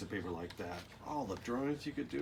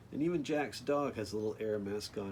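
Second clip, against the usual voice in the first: plain reading voice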